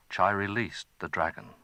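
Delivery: plain reading voice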